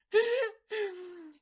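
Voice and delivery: high, loud burst of laughter; helpless loud laugh; real laughter. Real, not acted. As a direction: crack up at your own remark. Laughs at own harsh remark.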